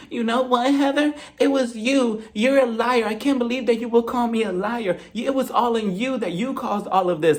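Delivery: high whiny accusing voice; high accusing whine. Retold or imitated. Whitney accusing Heather of lying, high whine. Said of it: "Whitney's just like".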